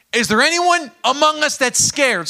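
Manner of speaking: loud, high call to the troops. Acted. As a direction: shout the question across the army. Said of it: "He says".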